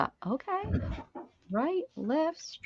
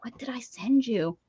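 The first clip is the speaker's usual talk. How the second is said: breathy whispered repeat; 5 dB quieter than usual. Shown as a demonstration. Whispering the question back to Jen.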